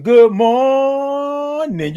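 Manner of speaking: "morning" with the "mor" held very long and high; drawn-out greeting. Real, not acted. Host greeting a viewer by name, drawn-out singsong "morning". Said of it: "I love all those O's and R's and I N Gs".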